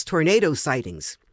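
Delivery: usual speaking voice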